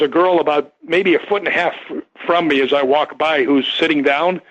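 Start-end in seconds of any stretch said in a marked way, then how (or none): none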